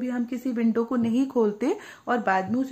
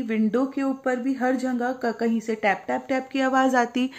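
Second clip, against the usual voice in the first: ordinary delivery, nothing marked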